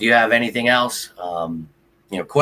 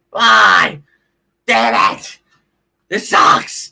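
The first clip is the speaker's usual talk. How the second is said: loud, exasperated sign-off; pissed-off fan's outburst; frustrated shouting; 10 semitones higher, 7 dB louder, much slower than usual. Real, not acted. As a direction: yell goodbye and curse the loss. Signing off still upset at loss.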